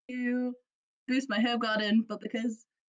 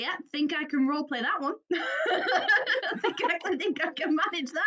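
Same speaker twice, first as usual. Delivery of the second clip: laughing reply; 5 semitones higher, 4 dB louder than usual. Retold or imitated. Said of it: "I was like".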